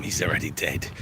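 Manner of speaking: hushed and grim; grim whisper. Retold or imitated. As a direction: whisper it like bad news. Grim line in retold trailer.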